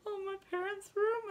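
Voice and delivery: high-pitched panicky wail; hammed-up frantic whine; imitated frantic distress. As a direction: squeak it out in mock panic. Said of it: "I probably looked so insane".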